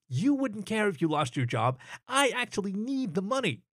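lightly voiced stressed horse pushing back; a touch of exasperation; frustrated coworker. Max arguing with lazy coworker.

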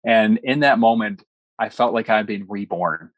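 ordinary talk

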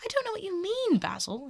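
high-pitched, exasperated protest; bewildered outburst; exclaiming. A narrator's reading. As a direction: exclaim it high, then drop on the name. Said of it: "he exclaimed".

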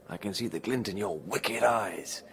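usual speaking voice

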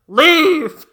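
loud, high command; booming shout. Acted. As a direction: bellow it from outside.

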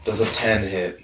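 ordinary talk

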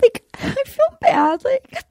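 put-on high, whimpering girlish pleading; halting mock-sobbing whine; tearful impression of Victoria. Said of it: "in her voice".

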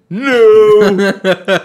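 high, loud, drawn-out mock yell; mock outraged objection. Imitating fans tweeting a correction, loud mock protest. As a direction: wail it like an indignant fan. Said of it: "tweets from people saying".

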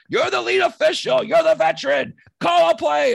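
high, loud heckling yell; yelling at the ref. Retold or imitated. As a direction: shout it down at the referee. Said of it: "Kitch is just giving it to him".

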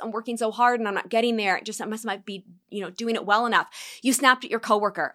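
ordinary talk

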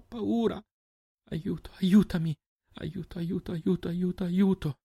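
high, frantic, climbing terror; rising panic. Acted. Terrified girl surrounded by blood, panic climbing to breaking point.

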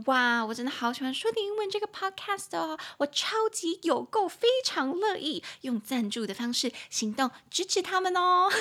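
high, eager, sugary fan voice; hammed-up fan praising the podcast; gushing fan. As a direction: gush like an over-eager listener. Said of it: "你可能就会跟他们说".

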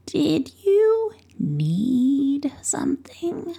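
lightly voiced croaky old hag, whispered; a hint of an old witch creaking from the doorway. Old witch surprising the boy at her door, lightly croaky. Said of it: "a croaky old voice behind him said".

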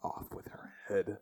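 unvoiced, soft playful whisper; mock whisper. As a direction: whisper the verdict like a secret. Joking about his wife.

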